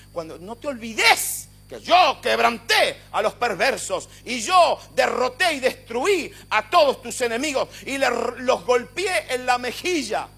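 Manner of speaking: booming shout as God, slow and weighty; God's voice. Acted. Preacher voicing God to David, shouting. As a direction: thunder it out as God proclaiming victory.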